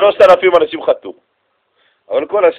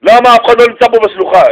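mock classroom shout, higher and louder; teacher yelling; 6 semitones higher, 7 dB louder than usual. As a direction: scold the empty room. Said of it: "למי תמיד צועקים בכיתה". (מורה צועק על הכיתה, קול רם)

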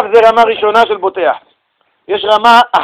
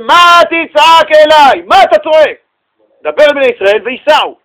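God rebuking loudly; 6 semitones higher, 6 dB louder than usual. Acted. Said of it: "ויאמר אדוני אל משה". (תוכחה רמה ואיטית, "מה תצעק אליי" בקול חזק וגבוה)

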